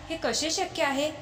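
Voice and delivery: a touch of disbelief, voice lifted; astonished. A narrator's reading. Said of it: "चकित होऊन म्हणाला".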